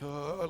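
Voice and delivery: a strained high groan of discomfort; tense groan. Retold or imitated. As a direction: let out a tight, knotted-up ugh. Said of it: "you're just like".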